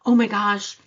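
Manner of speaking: loud alarmed gasp; panicked gasp. Retold or imitated. Coach mimicking a guilty panicked listener. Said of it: "you're already feeling that guilt".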